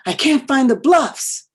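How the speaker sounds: a son calling his mother, loud and scared; panicked son. Retold or imitated. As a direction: cry it out like a lost kid. Son lost, panicking on phone.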